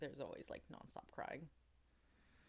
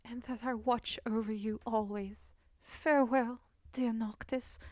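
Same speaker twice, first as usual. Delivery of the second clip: Luna's farewell line; 2 semitones higher than usual. Retold or imitated. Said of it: "the line where it's always like".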